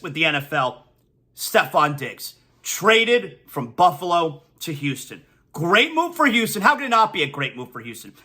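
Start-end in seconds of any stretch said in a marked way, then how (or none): none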